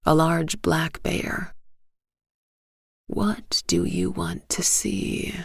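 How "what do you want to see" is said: slow, lower hushed whisper; oak whispering. A narrator's reading. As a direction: breathe the question like an ancient tree. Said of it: "the oak asked the bear".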